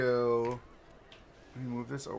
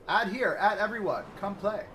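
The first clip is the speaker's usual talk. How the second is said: higher, louder mock pushy spammer; rude spammer; 7 semitones higher, 5 dB louder than usual. Streamer mocking a rude self-promoter, higher, louder, pushy. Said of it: "How rude is that ... I was like".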